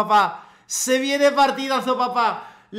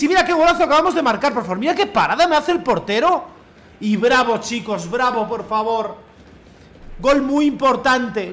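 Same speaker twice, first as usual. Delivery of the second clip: ordinary talk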